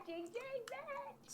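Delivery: very high, soft, drawn-out ding; playful chime. Pretend. Toasting the second gin, playful chime. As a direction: imitate a tiny bell, high and quiet.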